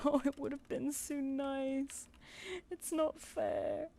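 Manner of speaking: high, slow put-on whimper; pouty, drawn-out complaint of mock self-pity; mock whiny sulk. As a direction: whine it like a sulking child. Retold whiny reaction to rejection.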